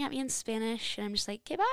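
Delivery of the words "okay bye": mock sweet brush-off to a caller; high, sing-out phone goodbye; retold hasty sign-off. Agent escaping a screaming caller, mock cheery sign-off.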